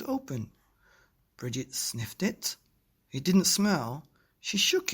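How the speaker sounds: put-on lively storybook reading for kids; reading to children. A narrator's reading. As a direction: read it like a bedtime story, full of wonder. Reading picture book to children.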